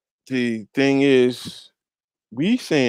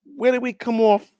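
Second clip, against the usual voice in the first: high, insistent repeated questioning; incredulous; 12 semitones higher, 5 dB louder than usual. Acted. Preacher asking where his people get the gall, high-pitched incredulity. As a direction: stammer it out high in disbelief.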